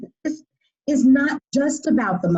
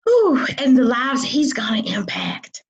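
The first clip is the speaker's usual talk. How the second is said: breathy whisper. Real, not acted. Moved host after touching story.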